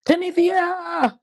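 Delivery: drawn-out high call of "Tanithia!", falling at the end; calling out name. Retold or imitated. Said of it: "that's how K said".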